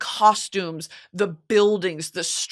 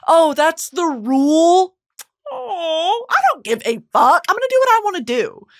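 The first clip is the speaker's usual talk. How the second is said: loud, high, mocking defiance; hammed-up, brazen backtalk; defiant old woman; 9 semitones higher, 6 dB louder than usual. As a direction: flout the rules with exaggerated sass. Voices defiant old woman's attitude.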